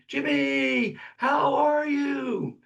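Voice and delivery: high, slow shout across a great distance; yelling across a distance. Retold or imitated. Plague-era caller hailing his friend Jimmy from afar, shouting. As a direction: call out to a far-off friend at full voice. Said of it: "So you'd yell out to your friend".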